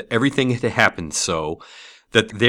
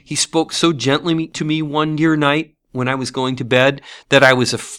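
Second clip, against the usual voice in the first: put-on higher-pitched little girl voice, overjoyed; brimful of glee; 6 semitones higher, 3 dB louder than usual. A narrator's reading. Little sister telling her brother of home, gleeful. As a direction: pour out the good news like a happy child. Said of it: "said the child, brimful of glee".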